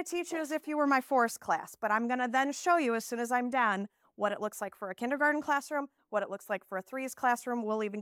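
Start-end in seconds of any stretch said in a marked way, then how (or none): none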